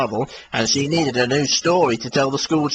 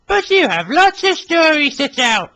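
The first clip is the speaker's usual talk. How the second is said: Billy voice; 5 dB louder than usual. Acted. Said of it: "said Billy".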